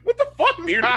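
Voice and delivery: very high, loud incredulous cry; squeaky-high retold protest; exasperated outburst. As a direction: react in hammed-up outrage. Acting own exasperated reaction.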